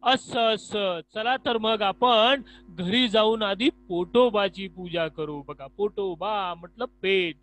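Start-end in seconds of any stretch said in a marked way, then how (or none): 0.0-5.4 loud, slow, cheerful invitation to come eat; eager friend. Acted. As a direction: call your friend home to eat, loudly. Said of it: "Shamrao बघा काय सांगतात".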